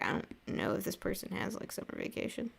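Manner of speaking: half-whispered private worry; hushed inner thought. Retold or imitated. Wondering to herself whether a viewer gets summer break, hushed whisper. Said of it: "and then I was like".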